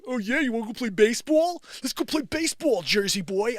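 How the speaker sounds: mocking classmates. Classmates teasing the Jersey boy, in a high mocking voice. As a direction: needle the new kid in a mocking singsong. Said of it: "They're like".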